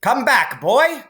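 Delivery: put-on high, loud shout after a boy. A narrator's reading. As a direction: call him back down, alarmed. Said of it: "she shouted".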